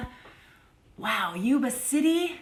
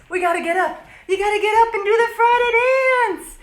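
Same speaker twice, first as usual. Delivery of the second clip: loud, high-pitched urging; excited call to the crowd; rallying shout; 7 semitones higher, 9 dB louder, faster than usual. Real, not acted. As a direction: shout to get everyone up dancing. Urging viewers up to dance.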